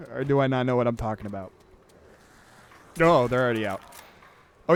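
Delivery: ordinary delivery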